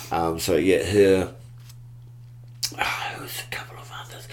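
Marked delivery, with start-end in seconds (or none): 2.8-4.3 whisper. Real, not acted. Fond remembering of friend.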